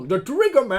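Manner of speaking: loud, high, drawn-out masked villain; over-the-top garbled villain bellow; muffled Bane voice. Acted. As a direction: shout slowly through your hand. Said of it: "est-ce que t'aimes bien les intentions".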